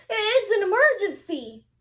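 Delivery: high, loud, urgent emergency cry. A narrator's reading. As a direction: sound like it's life or death, high and loud. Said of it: "cried Ozzie".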